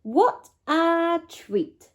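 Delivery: slow, high, contented murmur; contented sigh. A narrator's reading. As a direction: savour the comfort of bed. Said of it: "she said".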